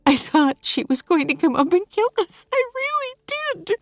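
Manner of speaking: high, fast frightened rush; Hannah, shaken. Acted. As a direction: tumble out the fear quickly.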